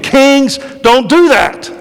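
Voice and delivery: high, loud scolding outburst; emphatic divine warning; stern rebuke. Retold or imitated. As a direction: call the kings out sharply. God rebuking kings.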